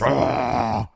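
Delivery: loud imitated monster roar; mummy roar. Host retelling the mummy meeting Rick, roaring. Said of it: "The mummy goes".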